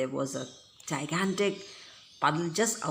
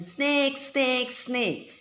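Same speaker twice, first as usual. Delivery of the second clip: gleeful yell; 7 semitones higher, much slower than usual. A narrator's reading. Prankster boy yelling at the girls, gleefully. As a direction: shout it with mischievous glee. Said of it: "all the time gleefully yelling".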